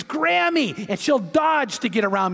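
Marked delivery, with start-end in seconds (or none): 0.0-0.9 high-pitched imitation of a toddler's squeal; high mock toddler scream; mild mock scream. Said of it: "Eleni screams".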